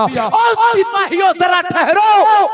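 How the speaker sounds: high, slow commanding call to the soldiers; a king halting his soldiers, drawn out. Acted. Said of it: "बादशाह ने आवाज दिया".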